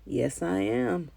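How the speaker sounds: soft, slow proud answer; proud reply. A narrator's reading. Granddaddy telling grandson he will vote, softly proud. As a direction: say it with quiet pride. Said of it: "my granddaddy proudly replied".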